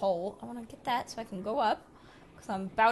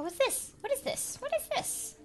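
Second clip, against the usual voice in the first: squeaky curious. Real, not acted. Curious find.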